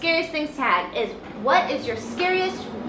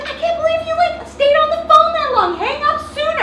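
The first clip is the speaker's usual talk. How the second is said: high, loud teasing brother voice, laughing; mocking brother; 7 dB louder than usual. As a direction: tease your sister from above. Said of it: "he's just like".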